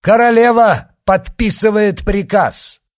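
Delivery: high, loud proclamation; ringing public shout; shouted announcement. A narrator's reading. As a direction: shout it to a crowd. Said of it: "крикнул коадьютор".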